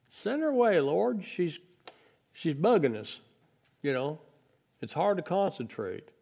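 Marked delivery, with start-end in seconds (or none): 0.1-3.2 whiny disciples. Acted. Disciples nagging Jesus, high whiny complaint. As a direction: nag the Lord to get rid of her.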